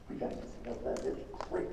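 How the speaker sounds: low whispered aside to his wife; telling his wife Kim in a low whisper. Retold or imitated. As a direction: whisper it so only Kim hears.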